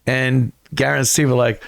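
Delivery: usual speaking voice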